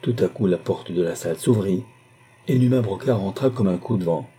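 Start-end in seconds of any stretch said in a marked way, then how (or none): none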